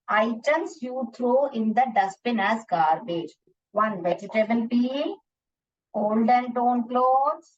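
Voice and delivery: very slow, word by word for writing; dictating answers slowly to the class; slow dictation. Shown as a demonstration. Teacher dictating slowly to children for their notebooks. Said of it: "जल्दी से लिख लीजिए".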